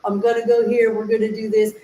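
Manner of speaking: clipped lazy US speech, words cut off; lazy American speech. Shown as a demonstration. Demoing lazy speech to voice students, words cut short. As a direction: chop each word short like casual American talk. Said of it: "lazy side with our speech patterns".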